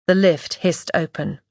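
plain reading voice

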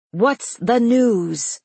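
loud, slow, commanding shout; thundering. A narrator's reading. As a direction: cut through the chatter loudly. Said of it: "Mara's voice thunders over the chaos".

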